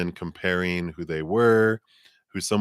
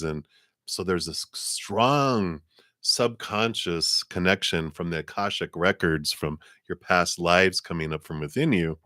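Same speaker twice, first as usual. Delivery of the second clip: normal talking voice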